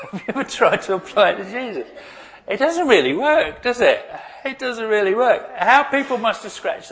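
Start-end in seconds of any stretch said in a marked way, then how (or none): 0.0-4.9 laughing. Real, not acted. Repeats question, laughing.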